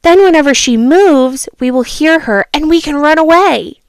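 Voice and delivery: storybook reading voice; a child reading a fable aloud; story narration. A narrator's reading. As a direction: read it like a bedtime story. Reads fable, excited.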